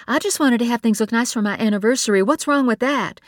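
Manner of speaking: hurt and bristling; wife justifying the anniversary effort; defensive anger. A narrator's reading. Nora defends herself.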